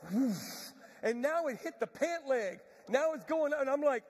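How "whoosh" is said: whoosh of flames catching; breathy whooshing noise; imitated whoosh. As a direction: imitate fire engulfing something. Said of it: "It said".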